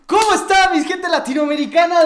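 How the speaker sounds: saludo aparatoso, agudo, fuerte y alargado; saludo de youtuber imitado. Retold or imitated. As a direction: imita un saludo de presentador a todo volumen. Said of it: "cómo decía?". (youtuber saludando a su público con voz exagerada)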